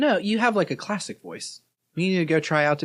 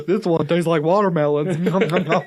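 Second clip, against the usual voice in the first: mock eating; 4 dB louder than usual.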